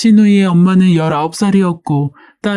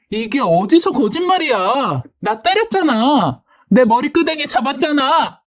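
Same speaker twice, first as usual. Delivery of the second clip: yelled accusation; 7 semitones higher than usual. Acted. Sister-in-law accusing the wife, high angry yelling. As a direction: accuse in a high, outraged wail. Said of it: "어찌나 크게 소리를 질렀던지".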